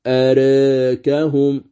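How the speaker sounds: أراكهم slow, the alif tilted toward e; recited with tilt. Shown as a demonstration. Teacher reciting أراكهم with the preferred tilt. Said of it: "هذا الوجه المقدم".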